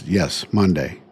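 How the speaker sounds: Monday said with a flat, falling finality; doctor's matter-of-fact Monday, lightly voiced; firm confirmation. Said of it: "He goes".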